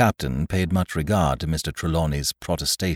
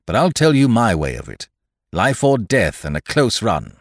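a little louder and higher, emphatic; lightly voiced seafarer; 6 semitones higher, 9 dB louder than usual. Speaker laying out their way of it, lightly voiced and emphatic. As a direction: state it with force.

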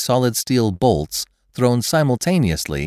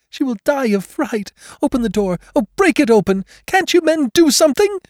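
high, louder panicked pleading; frantic wailing; 4 dB louder than usual. Acted. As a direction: beg the men in terror. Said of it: "wailed the mother".

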